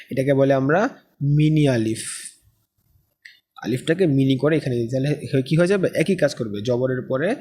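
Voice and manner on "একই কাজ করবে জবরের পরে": ordinary talk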